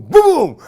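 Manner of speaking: loud high mock gunshot; gunshot boom. Man miming the gun going off, loud boom. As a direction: shoot the sound out.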